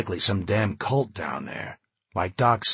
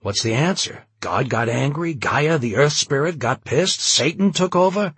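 climbing higher and louder with each question; agitated, rising to a high, loud peak; near hysteria; 6 semitones higher, 6 dB louder than usual. A narrator's reading. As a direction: fire off questions, building towards hysteria. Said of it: "on the edge of hysteria".

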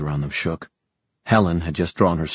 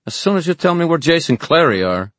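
raised, louder villain's voice; threatening demand; 11 semitones higher, 5 dB louder than usual. Acted. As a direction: press them hard for an answer. Villain bargains with a hostage.

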